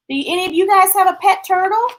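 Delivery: ordinary delivery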